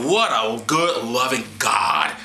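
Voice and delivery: normal talking voice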